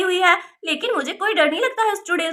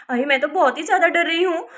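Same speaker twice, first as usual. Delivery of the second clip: usual speaking voice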